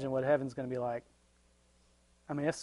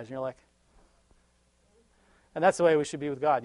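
ordinary talk, nothing marked

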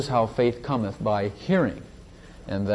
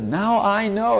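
joyful realization; 10 semitones higher, 3 dB louder, slower than usual. Retold or imitated. Organist's breakthrough retold.

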